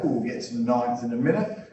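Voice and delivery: normal talking voice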